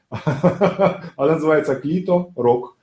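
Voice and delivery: slow, laughing through the words; can't hold back the laugh. Real, not acted. Laughing through his own joke. (сквозь смех, медленно)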